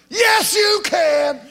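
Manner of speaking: shouted reply. Acted. Preacher answering doubters, high slow triumphant shout.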